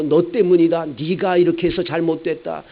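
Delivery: jab the blame at the wife; mock blaming husband. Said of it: "남편은 아내 보고".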